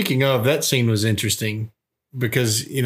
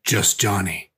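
flat tough guy; 2 semitones lower, slower than usual. Retold or imitated. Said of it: "And he goes".

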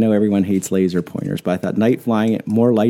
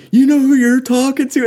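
high, louder challenging demand; playful threat, pitched way up; mock challenge; 13 semitones higher, 3 dB louder than usual. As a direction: call him out on who he's messing with. Big Ben confronts the yeller.

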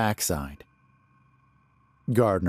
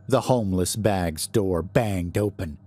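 plain reading voice

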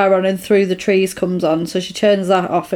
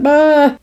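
short, high, loud mock shriek; mock startled scream; 6 semitones higher, 5 dB louder than usual. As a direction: imitate a scream of fright. Imitates her scream when freaking out.

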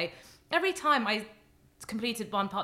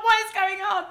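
very high, loud incredulous outburst; mock-frantic, high and loud; retold disbelief; 11 semitones higher, 7 dB louder than usual. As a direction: re-enact being utterly thrown. Retelling her shock at the call.

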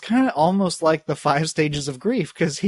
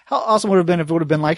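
ordinary talk, nothing marked

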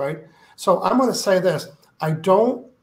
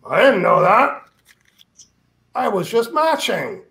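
law of attraction voice; 3 semitones higher, 3 dB louder than usual. Acted. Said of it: "that's not his voice. That's me".

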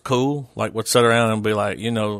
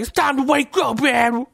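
much higher, playful wake-up call; a comic squeaky alarm voice; Tracy Morgan voice; 14 semitones higher than usual. Pretend. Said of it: "I want my phone to be like".

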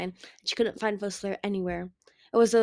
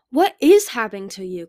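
sharp, louder demand; a louder, pressing demand; 3 semitones higher, 11 dB louder than usual. A narrator's reading. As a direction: confront him, loud and upset. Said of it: "Clearsight demanded".

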